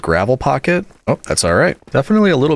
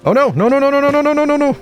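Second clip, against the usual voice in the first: panicked no-no-no; faster than usual. Real, not acted. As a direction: rattle off noes as it all goes wrong. Machine goes wrong, panicked.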